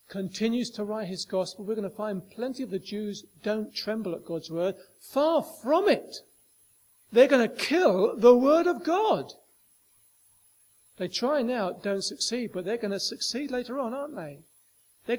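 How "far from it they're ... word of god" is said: forceful preaching. Acted. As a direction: hammer home the outrage from the pulpit. Preacher's climax on killing the Word.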